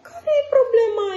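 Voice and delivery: high, loud eager question; eager exclamation. A narrator's reading. Jake asking the crew what is wrong, high and eager. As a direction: call out ready to help. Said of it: "exclamă Jake".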